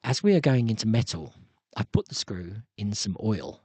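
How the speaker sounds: posh ASMR voice, stressing every single word; asthma voice. Acted. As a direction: drop low and enunciate each word carefully. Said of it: "when I've got my asthma voice, I talk very posh English".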